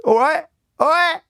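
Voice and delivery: high, squawked Cockney greeting. Acted. Host saying goodbye to old accents, hammed-up Cockney. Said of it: "'cause that would be Cockney".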